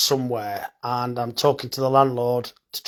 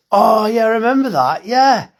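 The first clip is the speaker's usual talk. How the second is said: bright, loud recognition, pitched up; light up at the memory; delighted, louder remembering; excited recognition; 7 semitones higher, 7 dB louder than usual. Real, not acted. Recalls meeting, excited.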